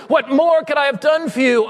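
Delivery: full-voiced preacher's shout; pleading cry. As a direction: cry it out to the church. Loud cry of the refrain.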